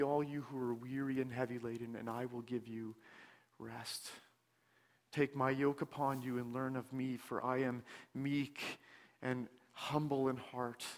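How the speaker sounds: soft, hushed reading of Christ's invitation; tender scripture reading. Acted. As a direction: read it gently, drawing the listener in.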